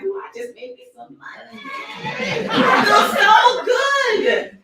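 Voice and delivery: high squealing delight, slowing to a laughing, shouted feel so good; giddy excitement. Retold or imitated. Consultant driving home after a sale, gushing with joy. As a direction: gush with delight over the money, laughing.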